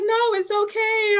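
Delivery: high, drawn-out imitated sweet voice; sweet reassurance. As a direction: reassure slowly in a high singsong. Imitating star's kind reply.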